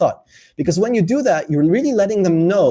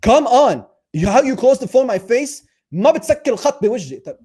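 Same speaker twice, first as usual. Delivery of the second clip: mock angry girlfriend; 8 semitones higher than usual. Girlfriend angry he hung up, mock scolding. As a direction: scold him like a hurt girlfriend. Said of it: "she'll call me and she'll say".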